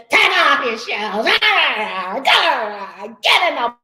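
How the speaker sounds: loud barked orders, half laughing; mock TSA yelling. TSA agent ordering a traveler through security, loud mock yell. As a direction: boss them around like a guard. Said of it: "they straight up yelling at you".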